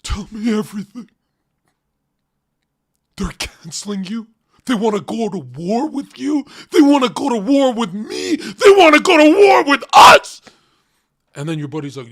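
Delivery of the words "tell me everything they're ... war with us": building from mock concern to a crying shout, higher and louder; hammed-up friend building to a shrieking yell; mock outrage building. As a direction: mimic a friend getting worked up until screaming. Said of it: "a real friend, what they do is".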